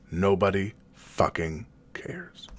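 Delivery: hushed, slow whisper of a thought; whispered inner thought. Acted. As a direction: breathe it like the voice in your head. The thought echoing in his mind.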